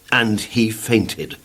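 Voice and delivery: ordinary delivery, nothing marked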